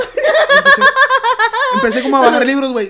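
high, loud, helpless laughter through words; bursting with laughter; laughing hard. Real, not acted. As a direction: tell it while cracking up. Cracking up at double meaning.

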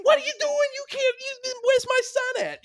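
very high, loud mock Long Island mom; hammed-up falsetto outrage; shrieking mother. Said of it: "And she was like".

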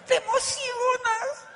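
high, stretched-out cry of excitement; ¡te emocionas! cried out with delight; excited cry. Acted. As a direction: throw the answer out with thrill. Rhetorical answer to audience.

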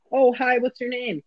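mock sweet greeting. Mock new kid dialogue.

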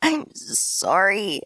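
slow, strained apology forced out through pain; coughed out. A narrator's reading. Injured narrator coughing an apology to Tansu, slow and strained. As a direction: push the words out weakly, hurt. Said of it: "I managed to cough out".

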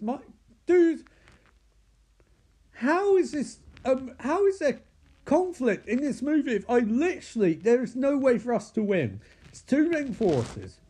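high-pitched incredulous protest; hammed-up disbelief, pleading for an explanation; exasperated actor. Actor storms off to complain to the director, exasperated.

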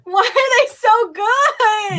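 loud, high, slow delighted question; excited gushing. Real, not acted. Gushing over store cookies.

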